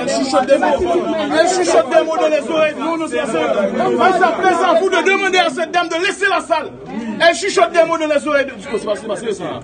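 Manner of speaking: very high, loud indignant protest; real outraged yelling at full pitch; shouted objection. Real, not acted. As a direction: shout your objection over the whole room. Heated objection at a hearing.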